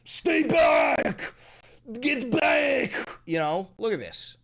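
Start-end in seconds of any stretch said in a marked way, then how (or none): 0.1-2.9 high shouted cartoon villain bark; Rhino villain impression. Streamer mocking the officer's accent, shouted villain impression. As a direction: shout it like a Spider-Man villain. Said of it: "He sounds like the Rhino".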